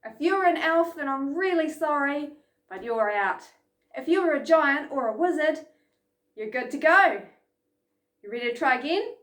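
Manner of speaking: ordinary talk, nothing marked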